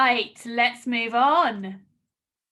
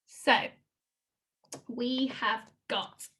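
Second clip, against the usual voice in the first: ordinary delivery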